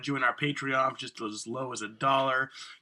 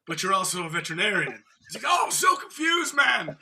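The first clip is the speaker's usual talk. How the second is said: high, louder put-on voice building to a yell; mock confused character; 6 semitones higher, 4 dB louder than usual. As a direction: play the baffled fool, louder.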